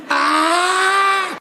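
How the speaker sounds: high, long-held "ah" scream; scream before impact. Acted. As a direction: scream as the crash comes. Braced for wheelchair impact.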